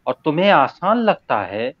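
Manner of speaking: emphatic unsung ghazal recital; spoken verse recitation. Acted. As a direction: declaim the couplet with rising, lingering phrase ends. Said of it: "मैं तहत में ही पढ़ता हूँ".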